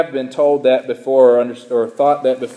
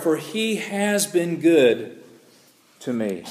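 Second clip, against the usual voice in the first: scripture reading; 4 semitones higher than usual. Acted. Pastor reading the psalm to the congregation, solemn and pleading. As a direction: read the psalm like a heartfelt prayer.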